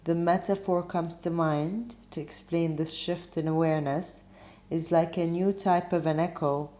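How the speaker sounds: usual speaking voice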